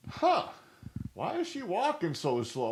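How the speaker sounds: high, loud puzzled question; wondering aloud why the dog drags. Retold or imitated. Retelling the walk, a high puzzled question. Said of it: "I said it out loud".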